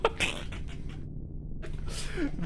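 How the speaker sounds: soft, airy laugh rising high; wheezy laugh. Real, not acted. Viewer joking about the Midnight Channel, wheezy laughter. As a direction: laugh breathily, pitch going up. Laughs at his own joke.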